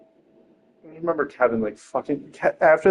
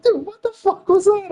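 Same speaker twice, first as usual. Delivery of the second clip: high-pitched mock incredulity; imitated disbelief. Friends reacting to the missed punch, mock-incredulous and very high. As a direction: squeak out the onlookers' baffled reaction.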